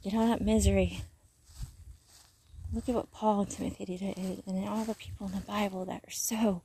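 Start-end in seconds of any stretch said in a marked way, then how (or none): 2.7-6.6 soft reverent whisper. Real, not acted. Telling viewers about the loyal people, whispered. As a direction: drop to a hushed whisper.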